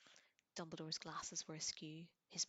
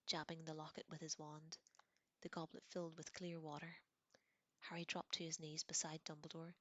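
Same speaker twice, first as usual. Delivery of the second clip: ordinary delivery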